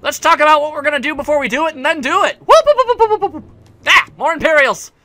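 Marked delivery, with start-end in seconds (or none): 2.4-3.4 high, loud whooping siren noise; mock siren whoops. Player mimicking an alarm while running, high siren whoops.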